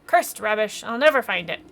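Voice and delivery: loud pirate-style cursing; frustrated fish captain. Acted. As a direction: explode at the worthless shell, in character. Fish captain curses the shell.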